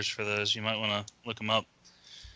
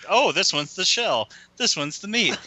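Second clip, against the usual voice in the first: mock kid excitement; 8 semitones higher, 3 dB louder than usual. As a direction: imitate a kid marvelling at each food. Acting out kid opening lunch packs.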